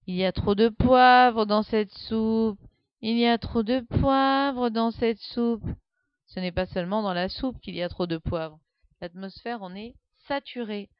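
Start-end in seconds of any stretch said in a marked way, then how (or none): 0.1-5.6 put-on slow, loud whining child repeating herself; plaintive little girl. A narrator's reading. Little girl complaining to the cook, slow plaintive whine. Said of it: "en répétant plaintivement".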